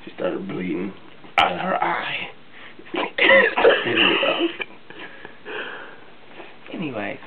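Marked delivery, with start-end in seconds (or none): 2.3-6.7 a loud, high burst of laughter; high breathy cackle. Acted. Cousin laughs at her own wild story, high cackle. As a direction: cackle at the punchline.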